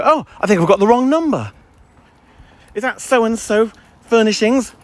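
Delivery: high, put-on polite doubt; pointed caller. Caller queries a bare hello, pointed mock confusion. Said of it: "I wanna go".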